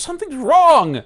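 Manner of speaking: high-pitched acted alarm, loud at first; panicked self-blame. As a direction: wail it in mock distress. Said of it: "right now what you're saying is".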